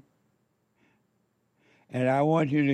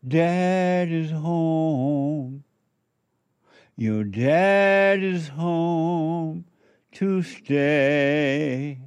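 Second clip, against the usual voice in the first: drawn-out proclamation; 2 semitones higher, much slower than usual. Acted. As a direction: stretch every word slowly, like a grand announcement. Proclaiming a quoted chant.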